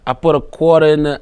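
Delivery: Boston accent attempt. Shown as a demonstration. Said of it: "I don't know what accent I'm doing".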